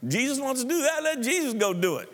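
high, whining mock protest; husband whining inside at his wife's vision; whiny refusal. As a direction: play the reluctant husband, whimpering no. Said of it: "baby, I'm thinking".

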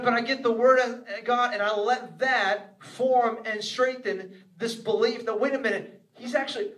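ordinary delivery

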